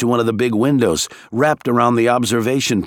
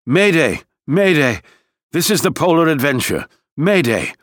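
panicked radio call; 5 semitones higher than usual. A narrator's reading. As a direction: cry mayday through a crackling radio. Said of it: "A panicked voice was crackling over the radio".

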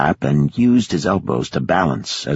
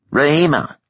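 a higher, sharp call of the name; shouted name; 10 semitones higher than usual. A narrator's reading. Ishmael rebukes the girl, a raised sharp call. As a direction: call her out sharply to stop her. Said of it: "Ishmael shouted".

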